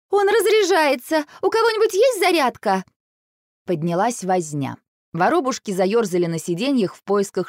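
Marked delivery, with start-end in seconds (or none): 0.1-2.8 very high, alarmed wail. A narrator's reading. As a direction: yelp in panic, pitch way up. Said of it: "тут же снова завопила". (вопит в панике)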